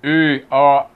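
E, A loud, low and open; E, A sounded out wide and separately; open vowel demonstration. Shown as a demonstration. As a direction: show E, A as long open vowels. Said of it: "tem que fazer isso aqui".

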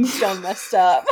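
imagined mirror scolding, played for laughs; dramatic mock scolding. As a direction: dramatically tell yourself off, half laughing. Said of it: "so dramatically looking in the mirror".

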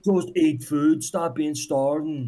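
high sneering know-it-all advice; sarcastic mock advice. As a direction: parody a smug adviser brushing off real hardship.

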